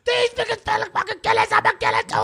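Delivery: high mock voice. Acting irate character.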